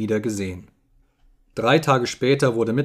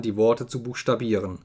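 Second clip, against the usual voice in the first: plain reading voice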